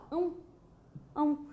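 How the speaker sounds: "Ão, ão" isolated as a sound example; demonstrating ão. Shown as a demonstration. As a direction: say "ão" on its own twice. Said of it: "esse som final". (demonstração do som "ão" isolado)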